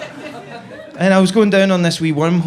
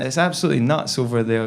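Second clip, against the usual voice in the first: ordinary talk, nothing marked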